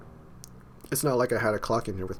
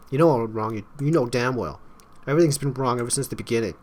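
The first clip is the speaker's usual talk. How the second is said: heated accusation; 4 semitones higher, 5 dB louder than usual. Acted. Acted quarrel, accusing.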